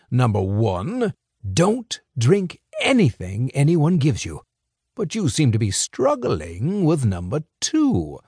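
put-on a sly older fixer, amused and in control; smooth crime boss. Crime boss lecturing two young couriers, sly and amused. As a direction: toy with the boys like a patient boss.

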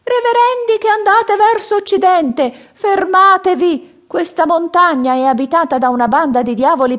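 put-on high, loud old man's cry from the slope; old man shouting a warning. A narrator's reading. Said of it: "gridò loro". (vecchio che grida l'avvertimento dall'alto, voce acuta e forte)

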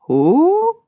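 high, loud, rising hoot; owl's hoot. A narrator's reading. Stare the owl asks Patsy, hooting. Owl hoots his question.

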